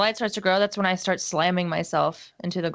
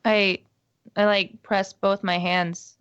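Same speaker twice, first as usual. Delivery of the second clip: ordinary talk, nothing marked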